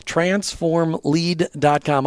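slow, deliberate URL read; slow and clear. Shown as a demonstration. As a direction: spell the web address out slowly. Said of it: "check it out online".

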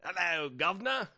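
hammed-up Cockney greeting; badly faked British caricature; bad British accent. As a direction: do a terrible British "guv'nor". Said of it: "you need to shit on my accents".

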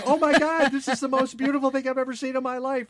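high-pitched, overwhelmed gushing, imitated; imitated freaking out. Host imitating a woman on her first night dive, freaking out. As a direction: act out a diver losing it with awe, pitch way up. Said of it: "she's freaking out, going".

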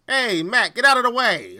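hammed-up Brooklyn accent, gruff city tough; 1950s Brooklynese. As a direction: play a pushy 1950s Brooklyn street guy. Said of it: "nineteen fifties Brooklynese".

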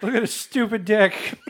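high, put-on taunting voice; hammed-up jeer; mock jeering. Joins in acting the jeering crowd.

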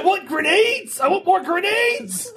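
high, drawn-out mock craving; playfully frantic, high-pitched begging; mock obsessive demand. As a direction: wail for them like a hammed-up addict. Acting out a suggested grenade obsession.

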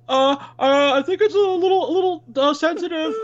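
high, nervous, laughing patient; timid patient voice. Retold or imitated. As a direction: squeak it out like a scared patient. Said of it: "And I'm like".

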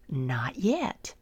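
low, soft whispered refusal. Acted. Master refusing the pleading clay, whispered. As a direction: gently hold firm in a hush.

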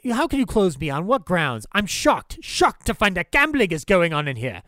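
hammed-up fury at being shut down; furious protest. Rick yells at Louis over the closing, mock outrage. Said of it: "Rick comes up to Louis and he goes".

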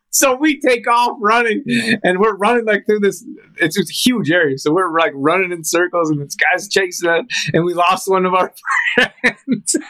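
giddy high voice reliving the chase; excited retelling. Real, not acted. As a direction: tell it like you're still running from him.

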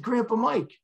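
high-pitched, urgent answer; retold excited reveal; agitated. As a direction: blurt it high, imitating an agitated son. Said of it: "he was very agitated".

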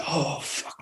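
imitated under-the-breath swear; stunned hush. Bassist hearing the new bass part, stunned hush.

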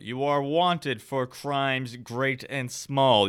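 measured, ringing herald's delivery; commanding knight. Acted. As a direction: declare charges as a pompous authority. Game master voices knight commander.

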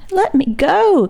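high, urgent cry to be let go; boy crying out to the drunk sailor. A narrator's reading. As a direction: pull away and cry out. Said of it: "cried Simon".

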